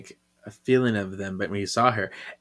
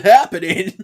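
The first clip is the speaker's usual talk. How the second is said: stunned; 7 semitones higher, 7 dB louder than usual. Retold or imitated. Coop stunned by a kiss.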